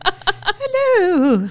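playful fairy godmother hello. Retold or imitated. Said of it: "like a fairy godmother talking to".

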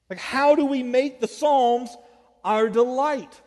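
loud, high, driving sermon delivery; impassioned preaching. Real, not acted. Preaches at full force.

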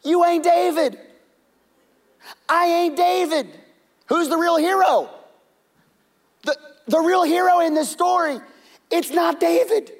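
very high, loud preaching punch; full-force preaching. Real, not acted. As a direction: preach it hard. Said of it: "I'm just gonna preach today".

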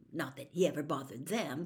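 ordinary delivery

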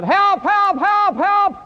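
high, loud acted distress call; yelled cry for help. Swimmer calling to the lifesavers, high yell. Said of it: "man yells out".

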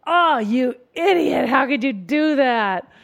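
loud, high, slow self-reproach; self-scolding. Acted. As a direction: berate yourself loudly, drawing out the words. Acted harsh inner voice scolding a mistake.

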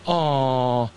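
cooing aw, mock pity; mocking baby talk. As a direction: coo at him like a baby. Mocking the fired forecaster.

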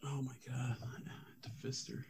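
shocked hushed whisper. Real, not acted. Stunned listener whispering at the hotel story. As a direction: breathe it out in disbelief.

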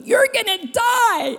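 high, drawn-out taunt; voice of unbelief. Acted. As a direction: stretch it out high like a looming doom. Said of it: "fear comes in, and unbelief goes".

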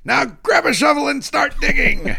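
loud, high cartoon pirate cry; piratey skeleton. Risen skeleton ordering the newly dug-up to dig, piratey shout. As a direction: crow it out like an undead pirate. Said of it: "very piratey rhyme".

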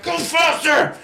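high excited cheer; excited urging. Retold or imitated. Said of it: "so we're like".

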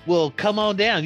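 ordinary talk, nothing marked

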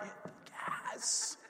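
hushed, slow hit the gas; whispered urging. Retold or imitated. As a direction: breathe it out low. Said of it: "I'm like".

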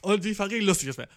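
ordinary talk, nothing marked

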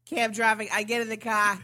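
put-on thick Boston accent. Sister tells bar pals about her cab ride, thick Boston accent. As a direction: imitate a Boston local telling a cab story. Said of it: "the thickest Boston accent".

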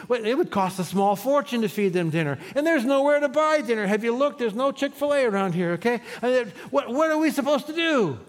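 high-pitched, whiny complaining; exasperated disciples grumbling at Jesus, high and whiny; griping disciples. Acted. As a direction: complain to the Lord as if the plan is absurd. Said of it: "they start to gripe and complain".

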